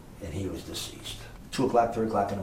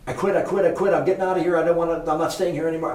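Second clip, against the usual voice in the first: imitating a frantic maid, much higher and louder; panicked quitting, faster at the end; 8 semitones higher, 9 dB louder, faster than usual. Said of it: "I get a frantic phone call".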